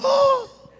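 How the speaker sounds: high, held "oh"; mock awed cry. Preacher grabs the snake by the tail, mock awed cry. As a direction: let out a long high gasp of wonder. Acts grabbing the snake, crowd reacts.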